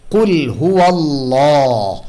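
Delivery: হুয়াল্লাহ with a heavy, full lam; slow recited কুল হুয়াল্লাহ, Allah made heavy. Shown as a demonstration. Tajweed teacher showing tafkhim: slow recitation with a heavy Allah. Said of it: "এটাকে তাফখিম বলা হয়".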